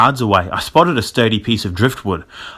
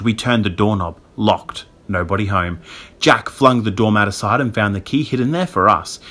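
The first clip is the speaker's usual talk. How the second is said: ordinary delivery, nothing marked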